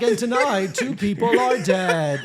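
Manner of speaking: mock newscast, drawing out each word slowly; over-enunciated news voice. Reporter parodying their old news tracking, over-enunciated. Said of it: "do the rest of the podcast in that voice".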